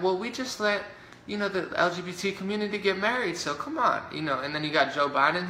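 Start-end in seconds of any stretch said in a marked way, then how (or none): 0.0-4.0 high, sing-song mock politician; sarcastic high-pitched impression. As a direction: play a smug official, pitched up high. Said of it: "then they're like".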